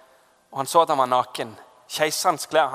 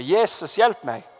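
higher-pitched retold plea for help; retold cry; 5 semitones higher, slower than usual. Preacher retelling his desperate moment to the congregation, mild cry for help. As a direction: call out to Jesus, only half shouted. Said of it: "Så jeg roper".

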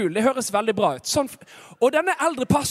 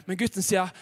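ordinary delivery

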